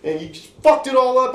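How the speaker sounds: high, loud outraged yell; angry yelling. Retold or imitated. Telling friends how they yelled at the barber, angry yelling. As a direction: retell it as a full-voiced, high-pitched rant. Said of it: "I was yelling at him".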